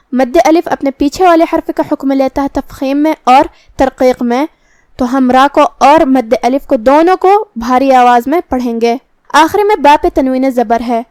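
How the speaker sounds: ordinary talk, nothing marked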